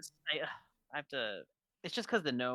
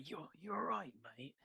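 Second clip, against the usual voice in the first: soft, higher attempt at an Australian accent; gruff Australian; 4 semitones higher, 9 dB quieter, slower than usual. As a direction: try a gruff Australian guy. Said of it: "He'd sound really gruff that way".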